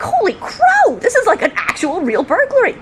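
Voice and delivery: high-pitched exclamation, easing as it goes; excited realisation. Retold or imitated. Officer realising the burglary is real, amazed outburst. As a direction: blurt it out in astonishment. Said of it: "And I was like".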